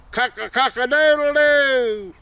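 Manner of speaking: high, slow, drawn-out crowing; pitiful rooster crow. A narrator's reading. Tailless old rooster on the barn roof, pitiful high crowing. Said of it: "it looking pitiful".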